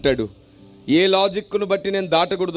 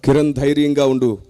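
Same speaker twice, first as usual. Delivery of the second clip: voice of God; 6 semitones lower than usual. Retold or imitated. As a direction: speak as the Spirit reassuring him. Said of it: "దేవుని స్వరం నాలో నుంచి".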